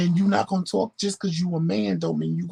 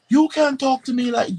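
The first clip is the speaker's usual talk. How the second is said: indignant protest; 10 semitones higher, 7 dB louder than usual. Retold or imitated. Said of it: "And he was like".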